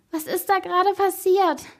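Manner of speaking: put-on high, loud, worked-up girl; agitated question. A narrator's reading. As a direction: ask loudly, all wound up. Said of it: "fragte Anna, eine Freundin". (aufgedreht und hoch gefragt)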